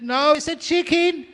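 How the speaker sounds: very high, comic insistence; mock squabbling. Wife fires back at husband over the bird, squeaky mock insistence. Acted couple's escalating argument.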